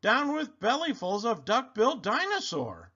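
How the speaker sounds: high, singsong hooted chant; hooting. A narrator's reading. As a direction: hoot the whole slogan up high. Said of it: "And he hooted".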